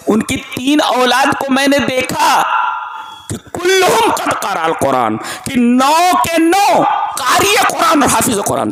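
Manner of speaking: lifted, laughing sermon climax; joyful preaching. Real, not acted. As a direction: exult over the nine reciters. Joyful climax about nine children.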